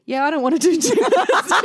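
put-on high, loud, laughing child; laughing kid voice. Mum mimicking Skylar quitting gymnastics, laughing. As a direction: play the fickle kid through laughter.

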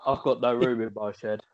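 usual speaking voice

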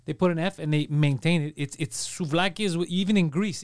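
ordinary delivery

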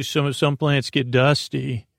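ordinary talk

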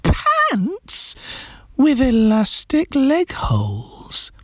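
high-pitched, drawn-out astonishment; exclaiming. A narrator's reading. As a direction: say it slowly, squeaky and high, word by word. Incredulous exclamation in story dialogue.

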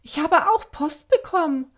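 high-pitched amazed question; astonished. A narrator's reading. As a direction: exclaim in delighted disbelief. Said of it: "fragte sie erstaunt". (erstaunt, ungläubig)

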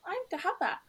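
high-pitched mimicry of the speaker; mocking imitation. Man mocking his date's accomplishments, mocking high voice. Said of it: "started, like, mocking me".

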